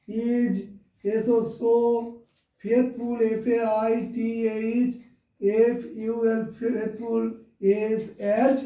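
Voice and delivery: slow, letter-by-letter spelling with long pauses; low, subdued voice, sounding out each word as it is written; dictating while writing. Real, not acted. Writing answer out, spelling aloud. (লিখতে লিখতে ধীরে বানান করে বলা)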